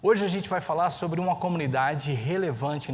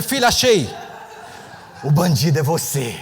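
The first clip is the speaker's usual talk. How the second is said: laughing triumph; 8 dB louder than usual. Retold or imitated. Father telling his daughter she had the phone, loud and laughing. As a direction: crack up as you catch her.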